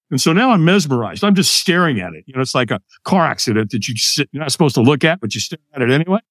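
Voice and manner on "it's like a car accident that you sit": usual speaking voice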